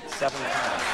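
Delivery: ordinary talk, nothing marked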